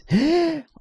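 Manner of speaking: high, voiced gasp of shock; sharp gasp. Real, not acted. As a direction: suck in a sudden high gasp. Reacting to something that just happened.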